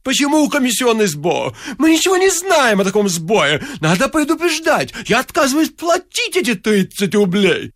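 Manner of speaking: high, spluttering protest; hammed-up sputtering tirade; indignant wailing. A narrator's reading. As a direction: refuse in an outraged high squawk. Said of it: "завопил он".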